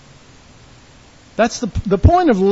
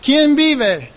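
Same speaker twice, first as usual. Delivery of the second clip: raised, higher-pitched call-out; crowd-rousing yell; 5 semitones higher, 4 dB louder than usual. Shown as a demonstration. Preacher demonstrating a Latin American call, raised yell. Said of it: "yell out real loud".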